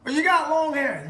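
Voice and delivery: high, loud mock complaint; imitated objector. Critics objecting to the candidate's long hair, imitated objection. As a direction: mimic a critic, pitched well up and loud. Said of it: "some of you will say".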